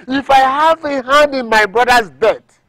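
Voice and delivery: loud, high-pitched vow; impassioned oath. Acted. Seller swearing innocence of his brother's death, impassioned oath. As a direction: swear it forcefully.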